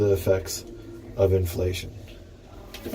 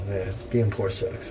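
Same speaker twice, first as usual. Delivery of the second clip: ordinary delivery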